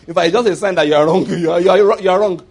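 normal talking voice

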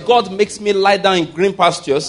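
loud, rousing preacher leading a declaration; call-and-response preaching. Acted. Pastor leading church in affirmations, loud and rousing. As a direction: drive the congregation to repeat each line.

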